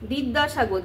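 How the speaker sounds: ordinary talk, nothing marked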